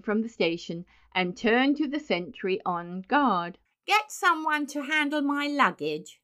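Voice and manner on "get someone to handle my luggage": high-voiced, businesslike command; brisk order. A narrator's reading. Benjamin ordering the sentry, briskly. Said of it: "he said briskly".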